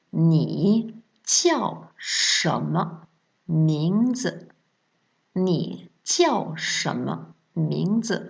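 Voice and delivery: "Ni jiao shenme mingzi" very slow, every syllable spaced out; slow model phrase. Shown as a demonstration. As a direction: say it slowly for learners to repeat. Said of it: "répétez après moi".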